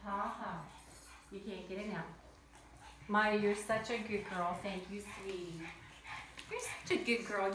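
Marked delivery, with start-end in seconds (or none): 3.1-7.5 a hint of pet-talk praise; praising the dog. Real, not acted. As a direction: praise your dog sweetly. Praising her pug.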